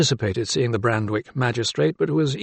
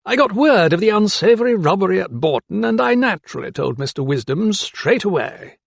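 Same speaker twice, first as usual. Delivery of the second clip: higher-pitched, mannered magistrate; 8 semitones higher than usual. A narrator's reading. Magistrate explaining news to doctor, high-pitched. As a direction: pitch it up for a self-satisfied official.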